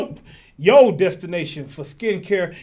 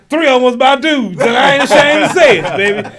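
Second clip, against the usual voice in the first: loud, higher comic brag through laughter; raised, unashamed joking holler; laughing boast; 5 semitones higher, 9 dB louder than usual. Real, not acted. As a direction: proclaim it loudly, cracking up. Comic boast during sponsor read.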